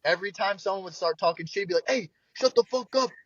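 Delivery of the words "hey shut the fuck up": high-pitched heckling bodyguard voice; hammed-up protective fan; imitated New York defender. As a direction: yell at hecklers on dad's behalf, mock-style. Imitates New Yorker defending his dad.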